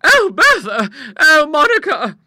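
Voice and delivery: very high, loud cries of distress; exhausted gasps. Acted. Sisters to each other after the fright, gasping. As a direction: wail each name high and long. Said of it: "gasped the poor exhausted gentlewomen".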